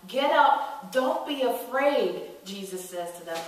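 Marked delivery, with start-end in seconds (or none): 0.1-2.3 louder, higher, slow on the last words; urgent call. Acted. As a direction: rouse them firmly. Jesus urging disciples.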